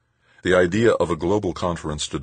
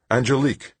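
blurted; 10 semitones higher than usual. A narrator's reading. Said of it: "he blurted".

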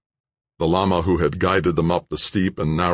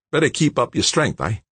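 higher and brighter, a big Aussie bloke talking up the night; young Aussie George; 9 semitones higher than usual. Acted. George's voice in the story.

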